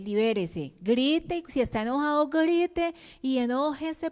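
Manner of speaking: mock pep-talk, rising and insistent; imitated urging advisor. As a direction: urge someone to let it out, voice climbing. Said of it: "hay gente que le dice a uno".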